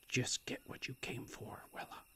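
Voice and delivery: hushed self-reminder, barely voiced; whispered to herself. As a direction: murmur it to yourself under your breath. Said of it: "she told herself".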